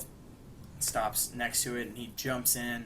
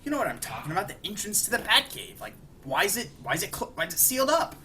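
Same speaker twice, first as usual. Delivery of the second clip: higher, agitated questioning; pitched-up, stumbling exasperation; exasperated demand; 8 semitones higher, 3 dB louder, faster than usual. Retold or imitated. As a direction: imitate a man getting worked up. Bruce demands answers about the entrance.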